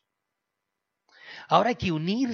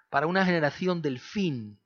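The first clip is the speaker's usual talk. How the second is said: ordinary delivery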